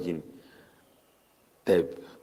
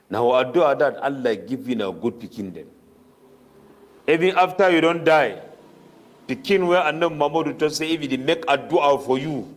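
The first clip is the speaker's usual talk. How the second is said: ordinary talk, nothing marked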